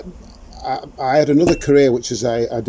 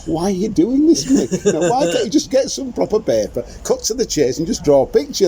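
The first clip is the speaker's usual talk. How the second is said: higher, exasperated voice of his own doubt; inner voice scolding; 10 semitones higher, 3 dB louder than usual. Retold or imitated. Artist's inner voice scolding himself for using paper bags, exasperated. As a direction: nag yourself like a fed-up inner critic. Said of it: "a bit of me going".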